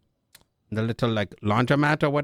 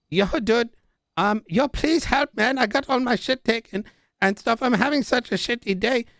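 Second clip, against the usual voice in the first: higher, hammed-up pleading; mock whiny plea; 8 semitones higher than usual. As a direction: whine for help like a wronged kid. Pleading with laughing captor.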